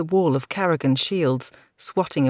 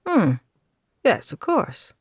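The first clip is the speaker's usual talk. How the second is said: lightly voiced lord; 5 semitones higher than usual. A narrator's reading. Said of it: "said Lord Kendrick".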